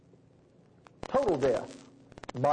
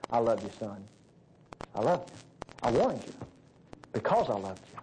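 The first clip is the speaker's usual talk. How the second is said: low, tender reassurance; comforting father; 8 semitones lower than usual. Acted. God comforting a son in His lap, tender and low. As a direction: speak low, as if holding a hurt child. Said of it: "comfort me and say".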